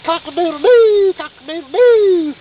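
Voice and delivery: high, loud rooster crow. Retold or imitated. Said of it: "well, I should say".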